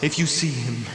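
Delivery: ordinary talk, nothing marked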